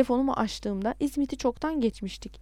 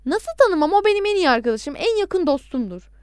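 higher, louder, firm assertion; unwavering voice; 7 semitones higher, 6 dB louder than usual. A narrator's reading. Caller claiming the victim as friend, voice unwavering. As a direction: insist without a tremor. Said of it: "sesi titrememişti bile".

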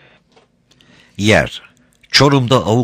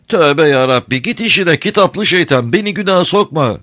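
high, sweet-talking crafty smuggler; sly, sugary old rogue; honeyed voice; 11 semitones higher, 4 dB louder than usual. A narrator's reading. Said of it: "tatlı konuşuyor".

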